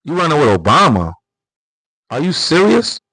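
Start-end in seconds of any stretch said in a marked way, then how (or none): none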